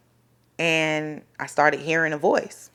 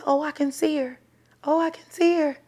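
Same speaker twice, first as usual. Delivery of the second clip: higher, softer voice of a watching mom; imitated peeking mother; 7 semitones higher, 5 dB quieter than usual. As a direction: quote her in a light, high, hushed voice. Retelling mom peeking over cloth.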